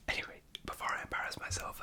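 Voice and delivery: normal talking voice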